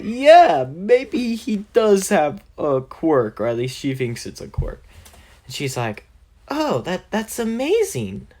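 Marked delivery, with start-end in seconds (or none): 0.0-3.4 high, loud start easing into slow words; hammed-up concession. Mom starts to believe Deku, high hammed-up concession. 6.5-8.3 high-pitched voice of an impressed mother; amazed mom. Acted. Mom hears of her son's power, high amazed voice.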